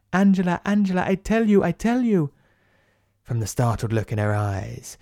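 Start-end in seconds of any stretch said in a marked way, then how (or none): 0.1-2.3 high, frantic repeated plea; begging the name in terror, voice climbing high; panicked pleading. Acted. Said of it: "all she can say is".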